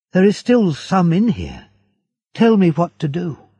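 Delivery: loud, high, desperate plea; urgent cry. Acted. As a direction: push hard, hoping to save him. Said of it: "he cried".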